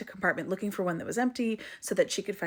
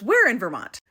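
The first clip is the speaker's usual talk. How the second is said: higher, louder retold question; keen surprise; 4 semitones higher, 7 dB louder than usual. Stranger on the train asks mom about home, retold keen surprise. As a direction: act out a stranger's piqued interest.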